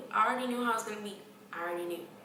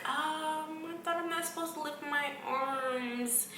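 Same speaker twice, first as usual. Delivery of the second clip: timid protest; 6 semitones higher, slower than usual. Retold or imitated. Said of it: "I was like".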